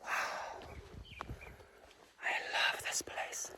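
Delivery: soft, voiceless whisper; hushed whisper. Real, not acted. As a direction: whisper it into the quiet around you. Savouring a very quiet spot.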